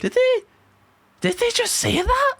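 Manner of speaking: high-pitched mock shock; retold disbelief. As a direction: act out being stunned by an insult, pausing mid-question. Retelling shocked reaction to agency's insult.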